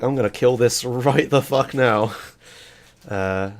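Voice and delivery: ordinary talk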